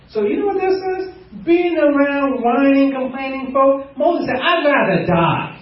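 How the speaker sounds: high, climbing preacher's cry; preaching at full force. Real, not acted. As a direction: lift the congregation with a soaring cry. Preaching climax.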